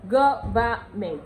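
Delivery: Government said slowly and deliberately; slow teaching repeat. Shown as a demonstration. Teacher repeating Government slowly for students. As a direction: spell out the word slowly. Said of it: "which simply means".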